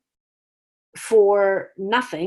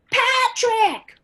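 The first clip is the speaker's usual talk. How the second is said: much higher, louder eager shout; kids shouting answer; 14 semitones higher, 6 dB louder than usual. Retold or imitated. Students excitedly yelling out the answer in a much higher voice. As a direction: blurt out the name. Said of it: "they'll name all the P names".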